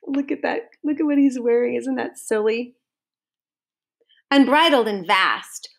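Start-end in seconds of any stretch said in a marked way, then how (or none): none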